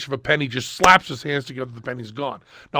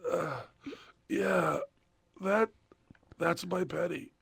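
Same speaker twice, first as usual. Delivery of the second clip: much softer, halting surrender; defeated murmur; 3 semitones higher, 13 dB quieter, slower than usual. Retold or imitated. Exhausted patient finally giving in, faint murmur. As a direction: give in barely audibly. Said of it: "whimpering defeated surrender".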